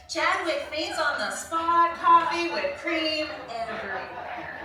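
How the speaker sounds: loud, slow, building punchline; excited. Real, not acted. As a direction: bellow the big finish. Climax of the story.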